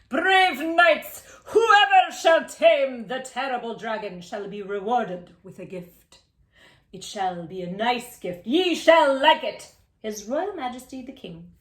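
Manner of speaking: loud, slow, pompous king's decree; slow booming royal announcement; royal proclamation. Acted. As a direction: proclaim it like a puffed-up king. King's proclamation read aloud.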